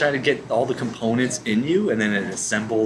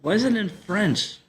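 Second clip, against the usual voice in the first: high, puzzled squeak of a question; pitched way up in confusion; baffled surprise; 10 semitones higher than usual. Real, not acted. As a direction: thrown by the language. Sudden confused reaction.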